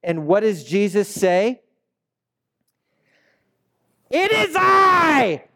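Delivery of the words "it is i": very high, very loud, slow shout; screaming over wind. Retold or imitated. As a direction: shout it over the howling storm. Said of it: "He's screaming".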